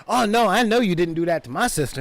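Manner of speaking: fast, sassy threatening; sassy mock threat. Battle nuns squaring up to the orcs, fast and sassy. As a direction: throw attitude at them. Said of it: "They're just sitting up".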